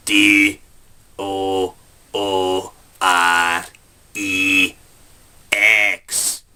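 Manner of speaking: slow, letter-by-letter spelling. Shown as a demonstration. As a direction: spell each letter out slowly. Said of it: "I spell it for you".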